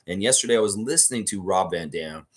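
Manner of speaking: usual speaking voice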